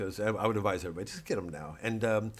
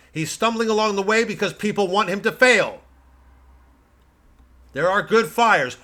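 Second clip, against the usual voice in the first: loud, high, ranting right-wing pundit; blustering pundit; 6 semitones higher, 11 dB louder than usual. Acted. Satirical pundit ranting to his audience, blustering loud. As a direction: bluster like a cable news blowhard.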